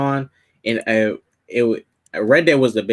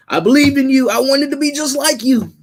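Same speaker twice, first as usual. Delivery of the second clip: high, loud retold cry of betrayal; hammed-up heartbroken protest to a mentor; anguished outburst; 4 dB louder than usual. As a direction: imitate a let-down protégé, pitched way up. Acts Peter confronting his fallen mentor.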